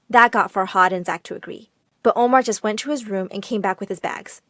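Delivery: ordinary delivery, nothing marked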